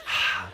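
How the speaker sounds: long low demonstration sigh; demo sigh. Shown as a demonstration. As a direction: breathe out audibly to show them. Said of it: "take a breath".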